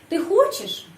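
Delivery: хочешь jumps high on its first syllable; sharp questioning peak on хочешь; question intonation. Shown as a demonstration. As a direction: ask it by a high lift on хочешь. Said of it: "saya menyusun kalimat tanya".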